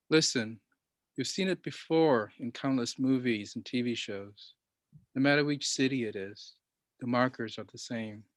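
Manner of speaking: measured poem recital, firm line openings; poetry reading. Acted. Poet reading his poem for the interviewer, deliberate recital. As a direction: read it out line by line, with pauses.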